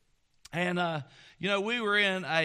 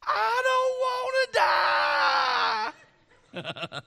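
very high wail breaking into laughter; child's scream; 6 dB louder than usual. Retold or imitated. Preacher imitating the girl's scream, collapsing into a laugh. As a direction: shriek it, then crack up retelling. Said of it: "she screamed at the top of her lungs".

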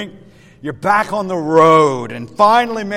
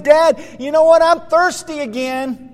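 put-on high, louder kid's complaint; complaining child; 8 semitones higher, 5 dB louder than usual. Son complains to dad on the highway, complaining child. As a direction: complain like a child in the back seat. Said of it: "he again complains".

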